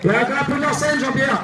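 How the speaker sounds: high, loud call to the sound man; loud call to Jean-Pierre. Real, not acted. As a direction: shout up to the booth. Calling to the sound engineer.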